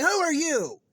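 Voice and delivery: high, loud frightened cry; startled, frightened yell; scared cry. Retold or imitated. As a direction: cry out in fright at the masked man. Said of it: "she's like".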